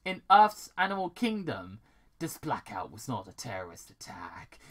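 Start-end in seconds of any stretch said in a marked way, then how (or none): 2.2-4.6 breathy secret whisper, all air. Acted. Villain whispering the plan to himself. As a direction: lean in and hiss the secret softly.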